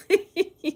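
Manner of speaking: quick high-pitched giggle; a brief bright laugh; short high laugh. Real, not acted. Laughs at own funny question.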